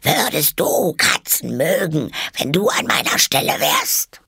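high, outraged creature voice; screeching, furious mouse. A narrator's reading. The mouse challenges Alice about cats, screeching furiously. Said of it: "mit kreischender, wütender Stimme".

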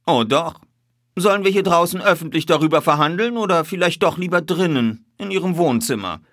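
high, loud, suave detective; self-assured detective. A narrator's reading. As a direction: press him politely with the upper hand.

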